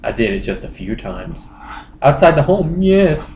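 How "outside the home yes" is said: normal talking voice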